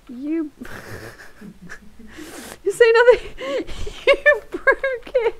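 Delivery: high, laughing through the words; laughing fit. Real, not acted. Streamer laughing at the glitching story game. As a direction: crack up at the glitch.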